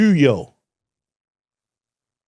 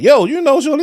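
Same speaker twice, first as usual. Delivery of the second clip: high excited question; 13 semitones higher, 5 dB louder than usual. Retold or imitated. Retelling his own question.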